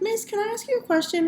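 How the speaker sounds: high-pitched imitation of a student; student asking teacher. Retold student's question to teacher.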